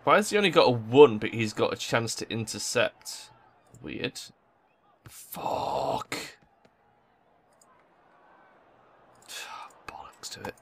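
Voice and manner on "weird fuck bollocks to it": whispered, deflated curse; defeated whisper. Real, not acted. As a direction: breathe it out, beaten. Defeated after conceding.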